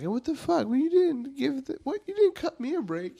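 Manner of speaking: squeaky high-pitched disbelief; high mock outrage. Teen whining at his parents in a high mock voice.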